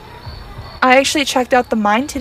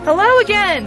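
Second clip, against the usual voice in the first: surprised high hello; 8 semitones higher than usual. Real, not acted. Device reacts while she wraps up.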